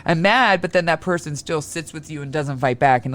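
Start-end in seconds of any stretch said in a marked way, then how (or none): 0.1-0.6 high, mock-exasperated cry of overwhelm; a hint of a stressed host snapping; mock frustrated outburst. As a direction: act out a fed-up host throwing up their hands. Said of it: "So kinda like this".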